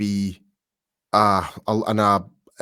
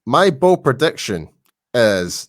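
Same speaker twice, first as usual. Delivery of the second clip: announcing; 8 dB louder, slower than usual. Real, not acted. Announcing his big prediction.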